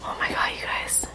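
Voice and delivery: very soft, whispered awe; hushed excitement. Real, not acted. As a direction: whisper it to viewers, amazed. Awed at the finished dish.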